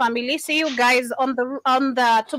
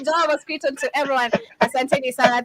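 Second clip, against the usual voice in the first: fast, louder radio sign-off; radio announcer; 2 semitones higher, 3 dB louder than usual. Acted. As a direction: close the show like a DJ.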